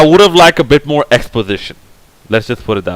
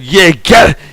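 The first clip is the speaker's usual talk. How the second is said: egging on the on-screen revenge like a rowdy crowd; hammed-up audience cheer; 5 semitones higher, slower than usual. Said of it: "they said".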